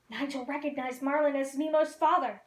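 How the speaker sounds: usual speaking voice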